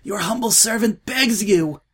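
high, loud pleading; begging cry. A narrator's reading. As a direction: cry it out desperately. Desperate plea.

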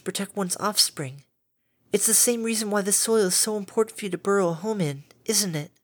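calm a frightened beast kindly; gentle caretaker Fluttershy. A narrator's reading.

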